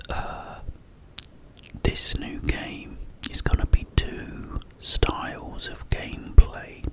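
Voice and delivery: ordinary talk, nothing marked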